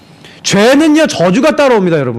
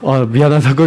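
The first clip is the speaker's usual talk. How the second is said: low, reluctant mock apology; grudging apology; 7 semitones lower than usual. A pastor grudgingly apologizing to his wife, low and half-hearted. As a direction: mutter the sorry grudgingly. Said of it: "제가 그래서".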